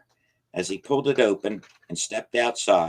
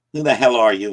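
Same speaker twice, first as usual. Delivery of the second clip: much louder shout; angry challenge; 4 semitones higher, 10 dB louder than usual. Acted. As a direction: shout at the shadow. Confronting the shadow figure.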